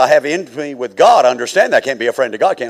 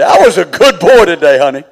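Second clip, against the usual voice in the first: put-on 1 laughing good boy; 2 semitones higher, 10 dB louder than usual. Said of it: "only a few times can I say".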